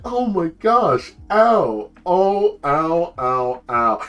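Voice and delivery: hammed-up, high moans of pain; milk a fake injury, drawn out and easing down; hurt turtle. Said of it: "And the turtle's like".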